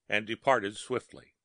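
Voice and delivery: plain reading voice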